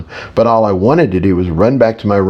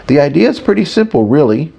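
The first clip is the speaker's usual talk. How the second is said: higher, quicker explaining voice; light character voice, pitched up and brisk; lightly voiced Eugene; 6 semitones higher than usual. A narrator's reading. Eugene explains shields to a student, lightly voiced and higher.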